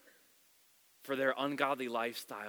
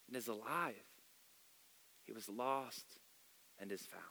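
soft, much quieter, tender; a father calming his angry older son, much softer; compassionate father; 6 dB quieter than usual. Retold or imitated. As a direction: speak gently to a hurt son. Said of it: "hear the compassion in his voice".

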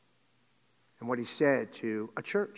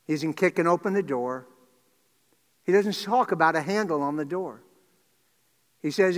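ordinary delivery

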